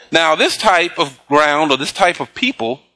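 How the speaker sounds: normal talking voice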